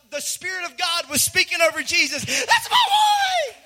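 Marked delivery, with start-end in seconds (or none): 2.2-3.6 very high, drawn-out ecstatic scream; full-out high-pitched cheering scream; screaming proud dad. Acted. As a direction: imitate a dad screaming wildly for his kid. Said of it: "That's how I think".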